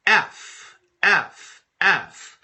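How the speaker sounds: excited announcement. Real, not acted. Host reveals the day's letter to viewers, excited.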